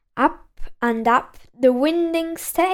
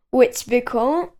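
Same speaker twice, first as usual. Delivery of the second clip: plain reading voice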